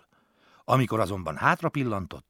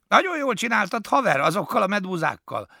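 much higher, louder cool dude praise; admiring praise; 13 semitones higher, 6 dB louder than usual. A narrator's reading. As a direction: praise him, impressed. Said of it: "mondta elismerően Kókusz".

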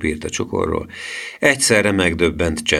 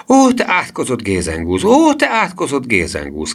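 excited cry; 6 semitones higher, 4 dB louder than usual. A narrator's reading. Grandmother greeting her grandson at the door, crying out excitedly. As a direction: cry it out high on each long "Ó". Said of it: "kiáltotta".

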